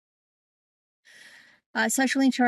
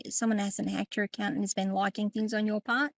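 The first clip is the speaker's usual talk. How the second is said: ordinary delivery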